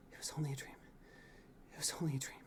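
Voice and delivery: calm yourself under your breath; whisper. A narrator's reading. Prince soothing himself after a nightmare, whisper. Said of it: "you whisper to yourself".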